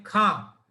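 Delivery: खां slow, nasal vowel and no n at the end; Urdu khan. Shown as a demonstration. Teacher shows the Urdu word to students, nasal aa. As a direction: draw out खां with a nasal aa, no final n. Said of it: "जैसे बिंदी होती है ना ऊपर खा पर".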